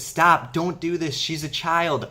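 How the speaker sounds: retold cry to spare the child; mock shouted plea. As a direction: shout to halt the sword, then beg, half-acted. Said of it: "Komurasaki says".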